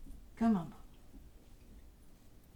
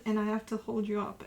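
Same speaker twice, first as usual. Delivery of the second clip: ordinary delivery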